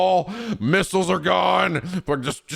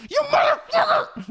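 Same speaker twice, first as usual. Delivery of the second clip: much higher laughing hammed-up scream; mock furious yell. Player attacking thief Joshua, a high laughing scream.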